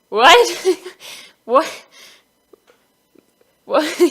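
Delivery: shocked, shouting high and loud; a stunned yell of what; freaked-out what. Retold or imitated. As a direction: blurt it out in total disbelief. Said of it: "I was, like, freaked out".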